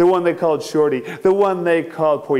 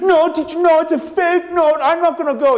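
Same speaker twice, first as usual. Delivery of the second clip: high insistent protest; pleading girl; 13 semitones higher than usual. Acted. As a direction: beg the teacher not to send her. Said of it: "She said".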